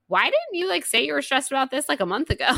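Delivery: high, loud exasperated retold question; mock exasperation. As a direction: imitate a wife's incredulous scolding, loudly. Wife retells scolding her husband.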